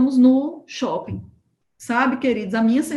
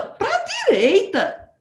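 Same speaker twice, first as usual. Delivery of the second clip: friend answering; 10 semitones higher, much slower than usual. Retold or imitated. Friend insists slowly.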